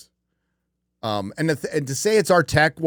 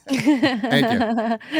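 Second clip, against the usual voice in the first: laughing thanks; 9 semitones higher than usual. Real, not acted.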